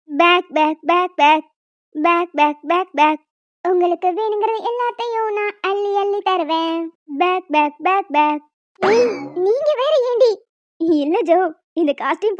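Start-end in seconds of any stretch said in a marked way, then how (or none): 0.1-3.2 quacking duck. Acted. Costumed friend quacking at the sister. 7.2-8.4 quacking duck. Acted. Costumed friend quacking at the sister.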